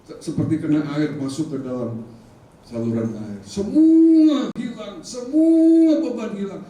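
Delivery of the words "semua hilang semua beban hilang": high, loud and slow; triumphant release. Acted. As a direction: sing out the relief. Climactic release, sung. (lega penuh sukacita, tinggi dan lantang)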